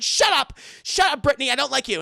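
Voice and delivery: loud, high retold snap; mock angry shout. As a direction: shout her down, imitating an irritated boyfriend. Retold boyfriend snapping at girlfriend.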